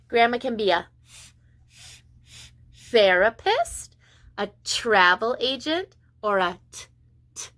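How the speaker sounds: ordinary delivery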